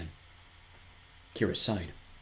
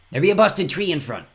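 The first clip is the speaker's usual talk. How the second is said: rough fen woman; 8 semitones higher, 8 dB louder than usual. Acted. Poor fen woman voiced.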